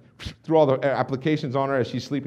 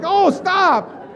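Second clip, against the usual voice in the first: high, loud mock-terrified plea; cowering in fright; 7 dB louder than usual. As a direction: cry out as if curling up from a scare. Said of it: "went down in the fetal position".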